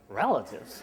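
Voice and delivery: "Relatives?" said low and soft, let down; deflated echo. Retold or imitated. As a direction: drop the voice on "Relatives?" in quiet dismay.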